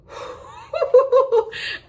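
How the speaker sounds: a burst of high, bright laughter; giddy high laugh; happy laugh. Real, not acted. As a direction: laugh out loud with joy. Laughing at her milestone.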